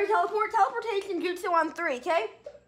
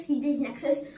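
ordinary talk, nothing marked

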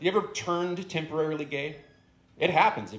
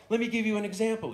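higher, quicker talking-dog voice; dog's rant, pitched up; 9 semitones higher, faster than usual. Acted. The dog starts his example for the stoned guest, higher and quick. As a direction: speed up as the dog warms to his point. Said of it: "he says".

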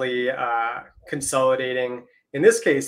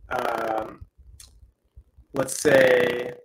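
glitchy, robotic mic sound; robotic voice from a broken microphone; much slower than usual. Real, not acted. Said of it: "you're sounding a little robotic".

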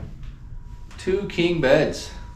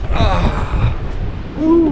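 high loud whoop; excited whoop; 10 semitones higher, 5 dB louder than usual. Real, not acted. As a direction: cheer as you make it down. Climbing down the cliff.

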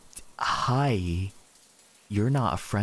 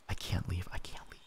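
scared breathless whisper; 8 semitones lower, 13 dB quieter than usual. Real, not acted. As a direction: whisper it, afraid to be heard. Trapped, whispering in fear.

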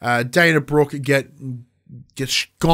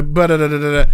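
vocal fight sound; 4 dB louder, faster than usual. Retold or imitated.

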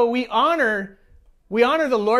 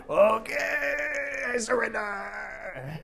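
long drawn-out strained wail, softer; mock reluctant surrender; 6 dB quieter, much slower than usual. Worshipper grudgingly surrendering his body to God, mock reluctant. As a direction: stretch the words out slowly in a hammed-up whine.

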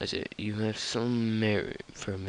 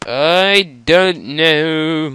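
drawn-out shout; 7 semitones higher, 16 dB louder, much slower than usual. Real, not acted. Drawn-out loud answer.

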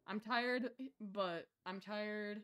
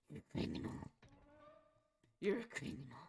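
soft, voiceless mock-menacing whisper; hushed hammed-up whispering; whispered accusation; 3 semitones lower, 7 dB quieter, faster than usual. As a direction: accuse them under your breath. Repeating accusation under breath.

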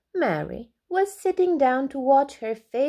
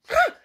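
startled wail; 5 dB louder than usual. A narrator's reading. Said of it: "wailed Alby".

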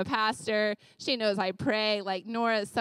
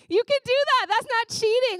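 laughing it out, pitched well up and louder; 11 semitones higher, 5 dB louder than usual. Real, not acted. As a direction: laugh through the reassurance. Laughing at own suggestion.

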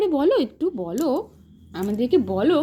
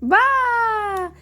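excited praise; 8 semitones higher, much slower than usual. Real, not acted. Toddler says corn.

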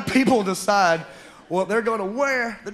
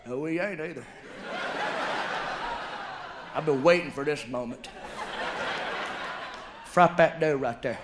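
much softer, lower scheming murmur; hushed conspiratorial buddy; 7 semitones lower, 8 dB quieter than usual. Retold or imitated. Fry cook buddy plotting fried dough, hushed and low. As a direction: lean in and plot it quietly.

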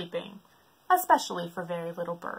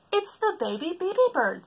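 lightly voiced higher giraffe; giraffe explaining; 11 semitones higher than usual. Giraffe tells the elephant, lightly voiced. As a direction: explain kindly. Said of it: "said the giraffe".

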